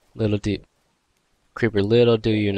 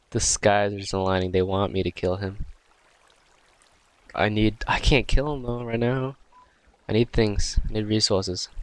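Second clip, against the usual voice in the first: usual speaking voice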